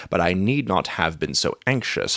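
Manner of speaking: usual speaking voice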